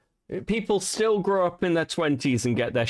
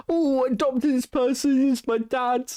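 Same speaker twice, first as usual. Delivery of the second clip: mocking quote; 10 semitones higher than usual. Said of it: "this whole".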